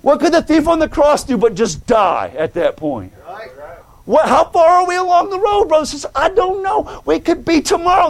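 high, urgent sermon delivery; impassioned pulpit plea; fervent preaching. Acted. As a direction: press the congregation with rising urgency. Preaches with force to congregation.

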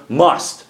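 "must" set apart and firmly emphasized; stress on must. Real, not acted. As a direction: land hard on the single word "must". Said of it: "I want to emphasize that word".

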